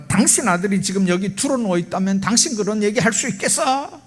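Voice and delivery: high-pitched retold angry protest; indignant retort. Father snapping back at the calming doctor, indignant retort. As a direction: throw it back as an outraged father. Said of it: "의사의 속을 막 뒤집어 놓습니다".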